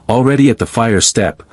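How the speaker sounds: ordinary delivery, nothing marked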